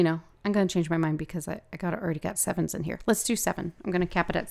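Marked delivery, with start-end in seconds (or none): none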